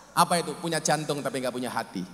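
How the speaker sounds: usual speaking voice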